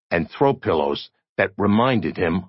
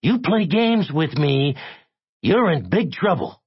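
high, menacing caller; high-pitched warning; threatening; 10 semitones higher than usual. A narrator's reading. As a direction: threaten him in a high, heated voice.

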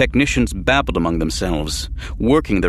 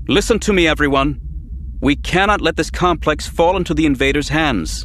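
rallying call; 6 semitones higher than usual. A narrator's reading. Rallying the staff in crisis.

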